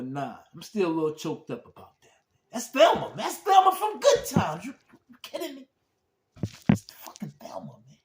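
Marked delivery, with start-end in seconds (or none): 2.5-5.7 high, loud thrilled shout of recognition; excited shout. Real, not acted. Fan recognizing Thelma. 6.7-8.0 hushed, breathless disbelief; awed whisper. Real, not acted. Awed at recognizing Thelma.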